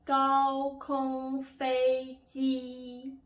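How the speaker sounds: 高空飞机 high, loud, held level and very slow; drawn-out level-tone drill on 高空飞机; first-tone demo. Shown as a demonstration. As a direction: sustain each syllable of 高空飞机 on one high pitch. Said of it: "these are the four tones".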